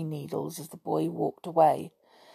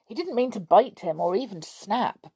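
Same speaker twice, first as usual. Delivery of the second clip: ordinary delivery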